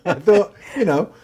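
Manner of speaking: talking through real giggles; can't stop laughing while explaining. Real, not acted. Laughing mid-sentence.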